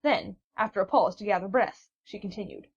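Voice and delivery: plain reading voice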